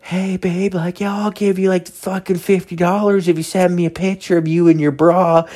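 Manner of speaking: high-pitched mock creep voice; imitated creep. Imitates a creep propositioning a girl.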